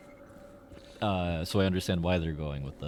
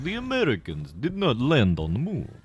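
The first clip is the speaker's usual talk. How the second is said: mock a Cold War Soviet in a put-on Russian accent; playful mock Russian accent as a sneering Soviet; 3 semitones higher than usual. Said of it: "Which I don't know why I gave them that accent".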